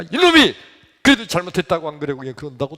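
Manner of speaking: loud high burst, then rushed rebuke; acted outraged father; escalating scolding. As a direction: scold harder at being talked back to. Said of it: "더 야단치고 막 그랬어요".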